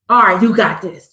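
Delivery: ordinary talk, nothing marked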